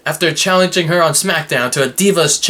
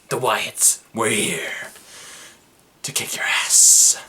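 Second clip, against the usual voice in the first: creepy whisper; 6 semitones lower, 4 dB quieter than usual. Acted. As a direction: whisper it like a horror villain. Spooky Wyatt family threat.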